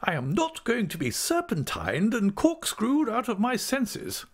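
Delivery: very high, emphatic outrage; great indignation. A narrator's reading. Aunt explodes at the squirming clerk, indignant. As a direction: explode in high-pitched protest. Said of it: "said my aunt with great indignation".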